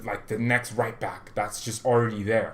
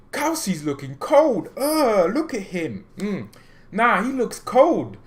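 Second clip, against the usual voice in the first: excited praise; 7 semitones higher, 4 dB louder, slower than usual. Real, not acted. Hyping a player's training.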